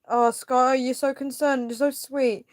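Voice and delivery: high, gushing thanks; touched. Real, not acted. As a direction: coo it high to a caring viewer. Touched by a viewer's concern.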